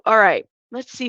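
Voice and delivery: normal talking voice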